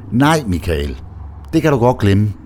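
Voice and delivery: firm refusal with a slight playful purr; firm but coaxing. A narrator's reading. Lise firmly but playfully refuses Michael, teasing. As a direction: set the limit firmly, yet a little teasing. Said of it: "siger hun bestemt, men denne gang også en smule kælent".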